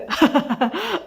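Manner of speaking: acted burst of laughter. A narrator's reading. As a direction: laugh out, climbing in pitch. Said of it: "آدم بضحكة".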